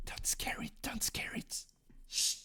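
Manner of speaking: hushed whisper. Pretend. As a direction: whisper so it won't run. Whispering to card again.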